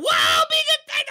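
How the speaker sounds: high, loud panicked shout. Real, not acted. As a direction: cry out under attack. Attacked again.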